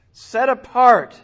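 loud, slow and punched out; booming sermon emphasis; loud emphatic proclamation. Acted. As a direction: proclaim it loudly, slowly. Preacher stresses key phrase.

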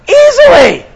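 sudden high, loud burst of confidence; emphatic exclamation. Real, not acted. Preacher answering his own challenge to the congregation, emphatically confident.

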